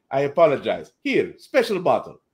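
put-on terrible Russian accent; Rocket Red. Russian hero making amends after a beating, bad Russian accent. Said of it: "it's a terrible Russian accent".

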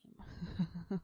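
caterpillar's laugh. Acted. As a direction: chuckle in character. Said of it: "The caterpillar laughed".

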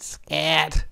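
a small high duck command. A narrator's reading. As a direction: shoo it away as a storybook duck. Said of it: "the duck said".

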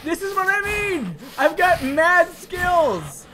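high, loud gleeful yell; excited shout. Real, not acted. Shouting to viewers about his skills, thrilled.

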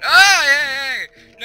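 very loud, high scream; startled yelling. Real, not acted. Player caught off guard by enemy, yelling. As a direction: yell out in sudden alarm. Sudden attack in game.

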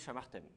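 "shamartem" with the first a kept; modern Hebrew shamartem. Shown as a demonstration. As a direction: say "shamartem" with a full vowel after sh. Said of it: "En hébreu moderne". (« shamartem » en gardant le a après ch)

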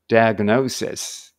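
Diagnosis slow, with the second syllable "o" stressed and drawn out. Shown as a demonstration. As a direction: lean on the "o" of Diagnosis. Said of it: "おうですね。".

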